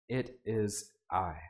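soft, low, slow; quiet assurance. Acted. Jesus speaking to the disciples over the waves, slow and soft. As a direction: say it slowly and quietly, full of calm. Jesus calling through wind.

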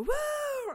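loud, high audience whoop; long high-pitched "woo", imitating an audience; imitated crowd cheer. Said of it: "and they go".

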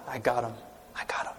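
a whispered, gloating Satan. Acted. Satan gloating to himself, whispered. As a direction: hiss it in secret triumph. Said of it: "He's in my court now".

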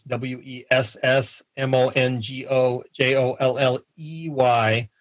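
slow, letter by letter; spelled out slowly. Shown as a demonstration. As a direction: spell each letter out carefully. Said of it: "I have to spell that".

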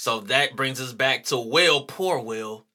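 usual speaking voice

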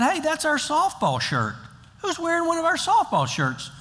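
high, surprised call across the field. Retold or imitated. Grandpa spots a church softball shirt on the field, surprised call. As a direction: spot your own team's shirt and call out.

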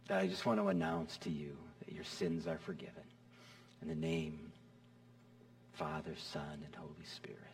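low, hushed blessing, whispered close; hushed absolution. Acted. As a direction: pronounce forgiveness in a near whisper. Pronounces absolution softly.

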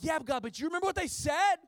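high, loud, quick indignant whine; hammed-up complaining to God; mock protest. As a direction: argue back with God like a sulky kid. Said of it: "And I'm like".

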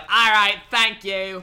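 hammed-up Cockney send-off; mock Cockney. Club owner dismissing the singer, mock Cockney. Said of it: "a Cockney accent".

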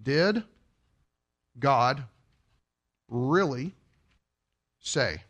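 each word alone with long pauses, voice sinking; slow word by word. Shown as a demonstration. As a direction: space out "Did", "God", "really", "say" for weight. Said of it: "Get this".

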